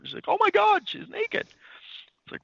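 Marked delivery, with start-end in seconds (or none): 0.3-1.5 high-pitched mock shock; shocked exclamation. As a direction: retell a stunned "oh my God" moment. Retold shocked character in comic scene.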